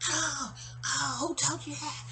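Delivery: high wailing mock yell; hammed-up outrage in a put-on high voice; Margot. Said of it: "Margot's yelling about".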